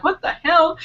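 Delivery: high-pitched mock disbelief; retold bafflement. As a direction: replay your own baffled outburst. Retelling own baffled reaction.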